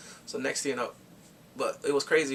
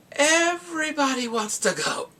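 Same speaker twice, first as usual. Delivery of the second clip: high, loud, drawn-out announcement; big high-pitched punchline; amazed reveal; 13 semitones higher, 5 dB louder, slower than usual. Real, not acted. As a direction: stretch it out in excited disbelief. Amazed reveal after "Guess what?.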